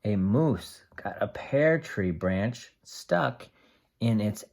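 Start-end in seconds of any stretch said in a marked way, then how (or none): none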